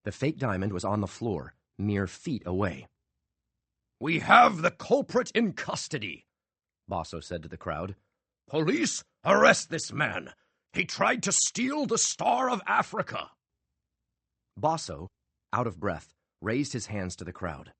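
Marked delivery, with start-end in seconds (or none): 4.0-13.3 commanding showman. A narrator's reading. Magician addressing crowd and police, commanding. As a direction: announce the arrest grandly.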